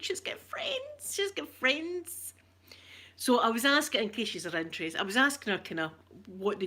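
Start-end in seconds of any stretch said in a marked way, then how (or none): 0.0-2.3 very high, slow, hushed disbelief; very high then whispered, slow, amazed; awed whisper. Retold or imitated. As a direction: breathe it out in tearful wonder. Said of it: "And I was like".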